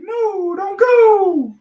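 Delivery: very high mock plea; mock wail. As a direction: wail it like a heartbroken kid. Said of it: "It's like".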